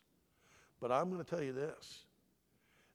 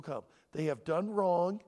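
ordinary talk, nothing marked

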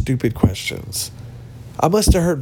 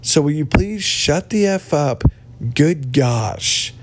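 ordinary talk

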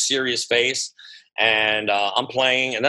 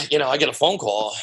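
normal talking voice

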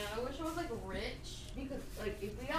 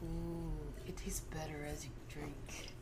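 whispering; 5 semitones lower, 9 dB quieter than usual. Real, not acted. Tasting drink, whisper.

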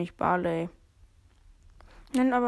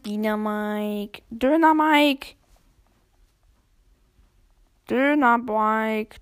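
drawn-out call; 6 dB louder, much slower than usual. Real, not acted. Greeting an enemy character.

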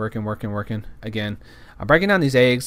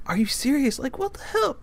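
incredulous; 13 semitones higher, 4 dB quieter than usual. Retold or imitated. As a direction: question him in strained disbelief. Retelling his disbelief at worker.

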